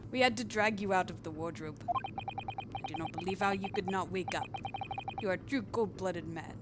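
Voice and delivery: amateur, hammed-up Russian accent. Russian guy accusing the prisoner, mock Russian accent. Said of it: "if my accent is offensive".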